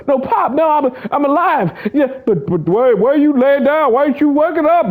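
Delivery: put-on squeaky little boy pleading; high-pitched child talking to his dad; high kid voice. Little boy pleading with Pop, high squeaky voice.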